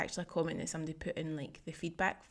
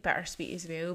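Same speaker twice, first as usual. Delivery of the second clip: usual speaking voice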